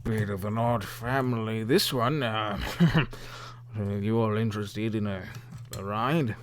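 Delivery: lightly voiced tipsy boatman; a hint of drunken old-hand boatman; drunk middle-aged man. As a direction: play him as a mildly boozy man. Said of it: "drunk middle-aged man".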